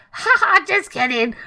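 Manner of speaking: high fake laugh, then the line; high-pitched mock brush-off; exaggerated joking tone. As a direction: ham up the fake "ha ha". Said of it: "when you say it in that tone".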